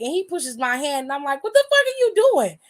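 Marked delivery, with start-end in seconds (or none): 1.4-2.6 pitched high and loud, outraged; mock outraged yell. Teller yelling at the one who grabs the snacks, high and loud.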